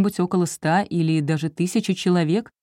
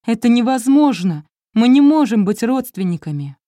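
distraught cry; 6 semitones higher, 6 dB louder than usual. Acted. Wife in shock at news.

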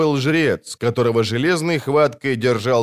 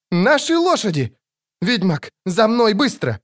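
high urgent shout; 9 semitones higher than usual. A narrator's reading. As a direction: yell for the horses. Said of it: "крикнула Мильва".

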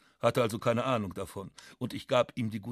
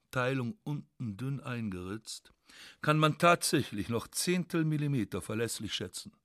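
plain reading voice

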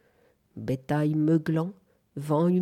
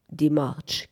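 ordinary delivery, nothing marked

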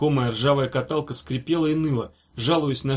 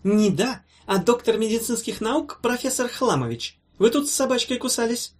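high, pompous rebuke; officious doctor scolding a patient; stern correction; 8 semitones higher than usual. A narrator's reading. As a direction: pull him up sharply in a raised pitch. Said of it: "строго отдёрнул его зелёный халат".

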